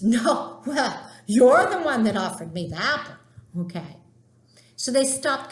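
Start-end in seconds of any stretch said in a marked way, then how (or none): 0.0-3.1 mock defensive retort. Eve snaps back at Adam.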